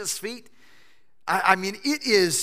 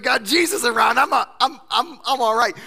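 cocky Lazarus; 6 semitones higher, 7 dB louder than usual. Pretend. Voicing confident Lazarus.

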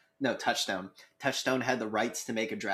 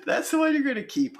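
high, laughing tease; teasing; 14 semitones higher, 4 dB louder than usual. Real, not acted. Teasing after the shout.

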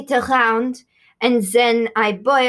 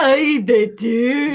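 loud, drawn-out Scottish mimicry; mock Scottish accent; 6 dB louder, slower than usual. Guest parroting the Scottish interviewer, mocking Scottish accent. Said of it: "Don't make fun of me".

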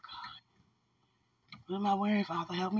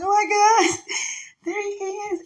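high-pitched, drawn-out happy cry; tearful joy; slower than usual. Retold or imitated. Retelling own reunion cry to partner, mock-tearful. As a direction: squeal it as if tearing up. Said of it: "I cried a little bit".

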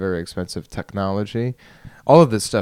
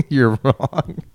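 laughing; 8 semitones higher, 4 dB louder than usual. Real, not acted. Laughing at own joke.

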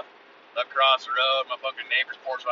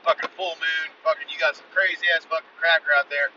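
ordinary delivery

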